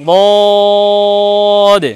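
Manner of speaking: heavy Arabic Dad in বদ, vowel held long; বদ as a long, level "dwaad"; tongue-side articulation. Shown as a demonstration. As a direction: draw বদ out with tongue side on the molars. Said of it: "এটা বেশি বেশি উচ্চারণ করতে হবে".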